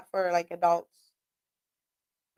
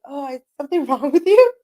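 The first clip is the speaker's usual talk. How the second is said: nosy neighbor; 6 semitones higher, 9 dB louder than usual. Retold or imitated. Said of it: "And the guy's like".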